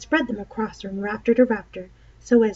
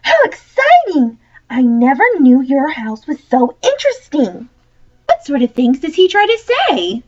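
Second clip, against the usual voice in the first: put-on much higher, louder thrilled girl; excited Polly; 5 semitones higher, 9 dB louder than usual. Polly to Diggory, thrilled by his spooky house. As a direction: gasp with delight at the mystery.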